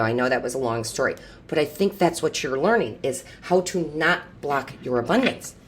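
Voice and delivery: normal talking voice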